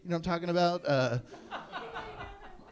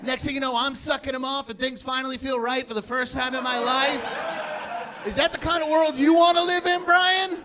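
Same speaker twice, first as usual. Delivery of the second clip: high-pitched mock outraged tirade; indignant rant; 7 semitones higher than usual. Ranting at Brian about the world he wants, indignant.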